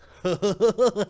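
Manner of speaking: high, loud goofy clown cackle; clown laugh. Acted. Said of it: "the clown voice".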